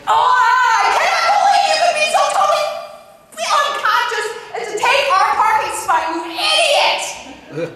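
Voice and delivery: hammed-up outraged scream; furious shout. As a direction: yell at the spot thief through laughter. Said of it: "That's what was inside".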